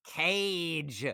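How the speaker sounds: Cage drawn out into one long syllable; lengthened letters. Shown as a demonstration. Explaining a friend's nickname, Cage stretched long. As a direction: stretch the sound of Cage slowly. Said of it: "lengthens the sound of those letters".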